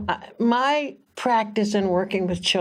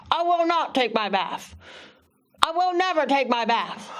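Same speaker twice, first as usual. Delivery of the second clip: put-on defiant boy; 6 semitones higher than usual. Defiant boy voiced in story.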